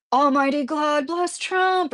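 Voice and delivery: high, drawn-out mock proclamation; mock zealous supporter. Streamer quoting a Trump-supporting commenter, mock zealous.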